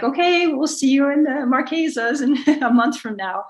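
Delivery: breezy see-you-later between sailing friends, half laughing; cheery goodbye. Retold or imitated. Sailor to buddy boat in the Galapagos, breezy cheerful goodbye. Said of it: "you're like".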